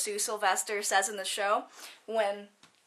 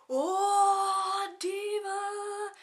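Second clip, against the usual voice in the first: mocking diva; 9 semitones higher, much slower than usual. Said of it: "we were all going".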